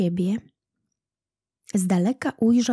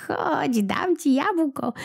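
put-on old woman; 6 semitones higher, slower than usual. A narrator's reading. Old woman offers the apple.

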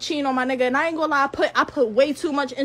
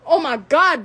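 ordinary talk

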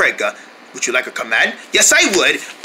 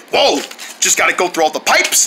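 ordinary talk

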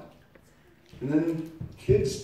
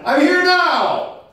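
impassioned cry; 11 semitones higher, 9 dB louder than usual. Real, not acted. Preacher insisting to the congregation, loud and impassioned. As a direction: cry it out to the whole church.

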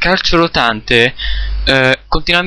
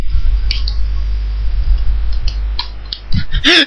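high breathy laugh. Real, not acted. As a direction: burst of high airy laughter. Laughs at opponent's cheap move.

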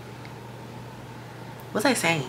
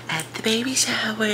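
higher, lilting sign-off; sweet raised-pitch see-you-there; playful sign-off; 5 semitones higher, 4 dB quieter than usual. Real, not acted. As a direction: draw it out like a cheery teaser.

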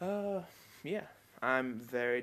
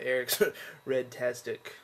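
laughing title; 2 semitones lower than usual. Shown as a demonstration. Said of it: "or I guess this way".

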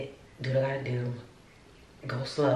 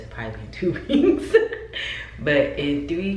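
laughing through the words; 2 semitones higher, 7 dB louder than usual. Real, not acted. Vlogger jokes to viewers about timing, laughing. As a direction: break into a laugh mid-sentence.

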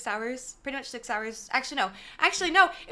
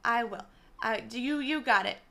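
ordinary talk, nothing marked

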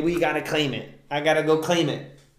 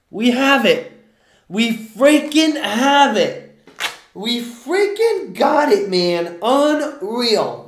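high, loud, slow stunned excitement; excited disbelief; 9 semitones higher, 6 dB louder, much slower than usual. Real, not acted. Streamer discovering the winning match, thrilled. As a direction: let the win sink in and shout it. Realizing they hit a match.

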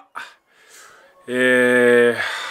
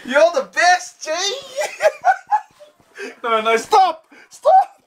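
laughing mock wail. Teasing his friend on camera, high laughing mock wail.

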